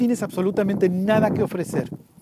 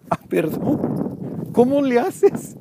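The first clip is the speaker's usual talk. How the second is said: sarcastic apology; 6 semitones higher, much slower than usual. Retold or imitated. Eli mocked, sarcastic reply.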